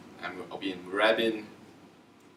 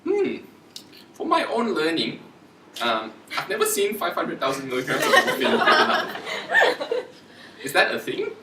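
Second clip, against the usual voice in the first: high, lilting mock-innocent query; raised pitch, politely unsure; polite feigned puzzlement; 12 semitones higher, 7 dB louder than usual. Shown as a demonstration. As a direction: wonder aloud, playing the puzzled learner. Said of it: "another way to put it is like".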